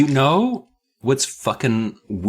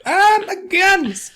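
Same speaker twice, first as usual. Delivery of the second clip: much higher startled shriek; mock scream; 4 dB louder than usual. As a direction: shriek in fake fright. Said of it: "both scream".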